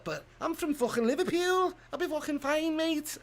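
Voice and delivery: very high mock Liverpool accent; Scouse accent. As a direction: send the Scouse accent way up in pitch. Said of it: "it sound like a Scouser".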